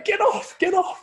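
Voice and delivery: high, loud shooing cry; mock shout. Wife shooing the guest off the turkey, loud and high. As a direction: shoo someone off the food.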